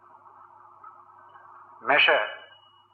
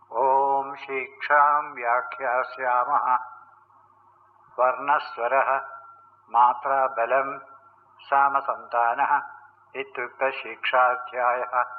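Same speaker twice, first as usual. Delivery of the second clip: slow, measured Vedic recitation; Sanskrit recitation; slower than usual. Real, not acted. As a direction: draw out the syllables as in reciting scripture.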